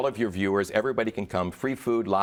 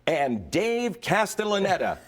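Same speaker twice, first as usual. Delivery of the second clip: big announcer intro, slowly; booming announcer; 11 semitones higher, slower than usual. Retold or imitated. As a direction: announce the name like a showman. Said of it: "and I go".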